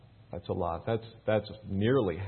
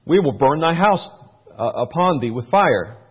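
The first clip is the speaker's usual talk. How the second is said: raised, louder Bible-reading voice; scripture reading; 4 semitones higher, 9 dB louder than usual. Acted. Pastor reading the Bible to his church, raised and declaiming. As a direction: read it out like a preacher to the congregation.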